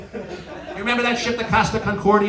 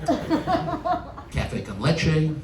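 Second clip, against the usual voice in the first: slow, offhand broken Spanish; mock nonchalant; 5 semitones lower, 4 dB quieter, much slower than usual. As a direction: toss off fake Spanish like it's nothing. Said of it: "I say very nonchalantly".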